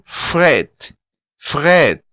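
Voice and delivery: fret, the t fully spoken; Creole word. Shown as a demonstration. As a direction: fret with the final t sounded. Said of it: "todas essas consoantes são pronunciáveis".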